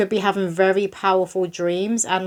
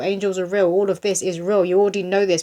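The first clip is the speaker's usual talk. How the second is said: ordinary talk, nothing marked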